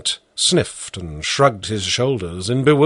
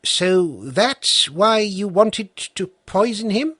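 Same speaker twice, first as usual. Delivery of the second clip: higher, slow, probing question; sharp judge; 9 semitones higher, slower than usual. A narrator's reading. Judge cuts in to question the peasant, slow and probing. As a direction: pin down whose clover it was.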